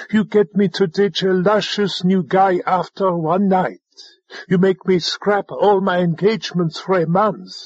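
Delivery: understated scream of protest, rising in indignation; indignant screamed tirade. A narrator's reading. Alva berating the drone over her pets, indignant. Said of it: "Alva screamed".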